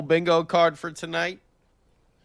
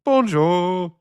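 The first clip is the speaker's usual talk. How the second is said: drawn-out French-accented greeting; 4 dB louder than usual. Shown as a demonstration. Host trying French on request, French accent. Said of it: "can you do a French accent?".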